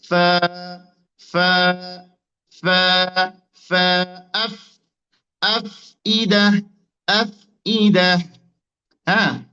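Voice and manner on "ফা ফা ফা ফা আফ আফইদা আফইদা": ফা and আফ with air let out through the f; demonstrating Fa. Shown as a demonstration. Teacher drills learners on releasing air, airy ফা. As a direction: sound out ফা, then আফ, each separately and drawn out. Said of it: "আর একটু বাতাস বের করবেন".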